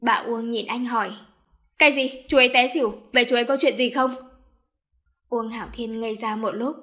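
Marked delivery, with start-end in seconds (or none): 1.8-4.2 louder, quick alarmed question; alarmed surprise. Acted. Son alarmed to hear his uncle fainted. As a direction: jolt at the news.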